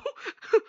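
quick high-pitched giggle; a short squeaky laugh. Real, not acted. Recapper cracking up at her own Davos line, high giggle.

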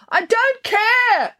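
high, long-drawn wail; petulant high-pitched howl through a door. A narrator's reading. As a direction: wail it loudly, stretching the words. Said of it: "wailed Sophia".

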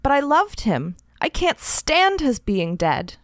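a pretend tearful plea to the police; mildly tearful. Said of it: "saying tearfully".

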